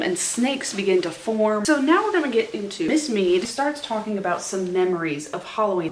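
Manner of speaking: ordinary talk, nothing marked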